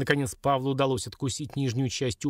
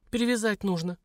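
exclaiming; 8 semitones higher than usual. A narrator's reading. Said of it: "воскликнула Лилька".

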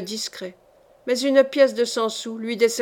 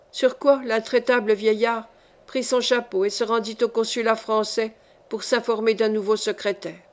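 usual speaking voice

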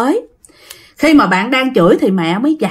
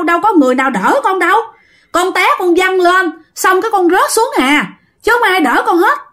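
bystanders disbelieving; 7 semitones higher than usual. Retold or imitated. Bystanders telling the child no one.

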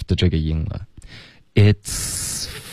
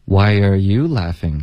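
"you" 重读降调; 质问地点出 "you"; slower than usual. Shown as a demonstration. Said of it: "跟着老师把这种感觉读出来". (跟读时强调 "you")

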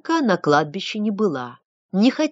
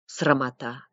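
ordinary delivery

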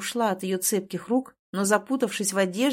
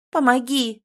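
higher, louder cry for help; pleading; 4 semitones higher, 5 dB louder, slower than usual. A narrator's reading. Heroine begging the man at the door, pleading. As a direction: plead for rescue, mildly acted. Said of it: "взмолилась".

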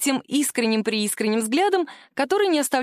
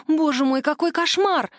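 gasped dismay; 7 semitones higher than usual. A narrator's reading. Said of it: "выдохнула она".

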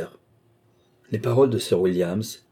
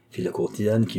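usual speaking voice

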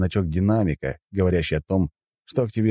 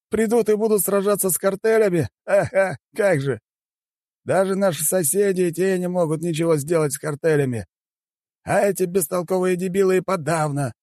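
high-pitched, mocking official; scornful chairman; 13 semitones higher than usual. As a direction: scoff at the enemy in a high voice. Chairman mocks the empire. (высокий насмешливый голос председателя)

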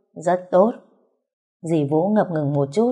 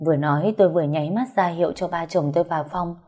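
ordinary delivery, nothing marked

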